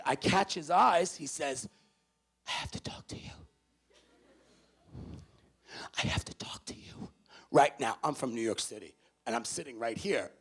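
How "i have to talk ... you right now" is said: whispered urgent plea. Retold or imitated. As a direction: whisper it so the women can't hear. Urgent secret request.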